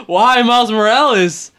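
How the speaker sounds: loud, high, drawn-out outcry; exasperated protest. Real, not acted. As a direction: cry out in disbelief, stretching the words. Protesting news of a cancellation.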